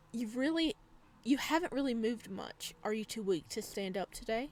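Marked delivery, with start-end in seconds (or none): none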